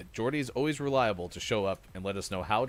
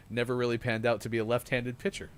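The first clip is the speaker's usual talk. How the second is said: ordinary talk, nothing marked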